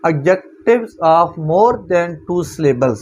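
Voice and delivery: very slow, word by word rule reading; slow dictation. Shown as a demonstration. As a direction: read the rule out slowly for notes. Reads rule title aloud. (धीरे-धीरे नियम पढ़ना)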